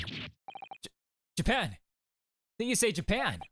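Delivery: high, incredulous exclamation; startled outburst. Acted. Prison governor hearing where the visitor is from, startled. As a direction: repeat it high, in astonishment.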